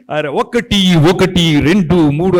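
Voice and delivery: loud, punchy ad shout counting one two three; shouted ad countdown. Shown as a demonstration. TV voice-over artist demonstrating his famous ad, loud shouted countdown. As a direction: bellow the ranks like a TV ad voice-over. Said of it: "అని చెప్తాను".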